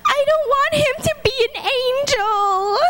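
high, tearful child's voice, lightly imitated; imitating a young girl crying over a lost pastor; crying little girl. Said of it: "And she says".

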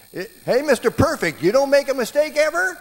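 high, loud angry yell; screaming. Retold or imitated. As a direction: scream it at the other driver.

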